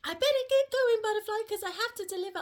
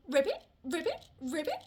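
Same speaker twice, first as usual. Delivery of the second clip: frog croak imitation; 5 semitones lower, faster than usual. Relaying Butterfly's clue about a hopping friend, frog imitation. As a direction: lower "ribbit" flicking up at the end. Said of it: "Butterfly says that they hop and go".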